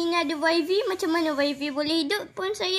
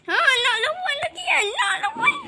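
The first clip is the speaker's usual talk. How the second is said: taunting; 11 semitones higher than usual. Acted. Taunting enemy.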